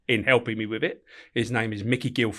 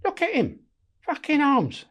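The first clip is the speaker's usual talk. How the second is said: much higher, nagging wife voice; mocking wife; slower than usual. Inmate mimics his wife scolding him on visit, high-pitched nag. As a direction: squeak it out like his nagging missus. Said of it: "And my missus says to me".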